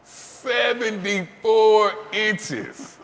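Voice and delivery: high, very slow, amazed; stunned repeat. Real, not acted. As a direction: savor the disbelief. Reacting to the huge number.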